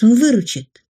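plain reading voice

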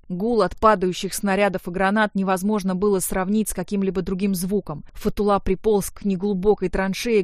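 plain reading voice

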